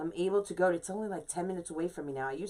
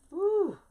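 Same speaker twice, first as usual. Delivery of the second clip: sharp pained ooh; 4 dB louder than usual. Real, not acted. Burned again on the finger.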